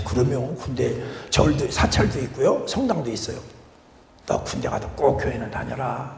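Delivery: quiet, lightly voiced Jeolla-dialect father giving advice; an old father's advice in a hint of Jeolla dialect; father telling his son in Jeolla dialect to attend church. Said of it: "전라도 말로".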